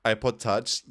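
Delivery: normal talking voice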